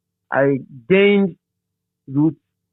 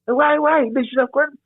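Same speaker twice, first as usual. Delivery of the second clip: imitating angry boss; 12 semitones higher than usual. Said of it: "He said".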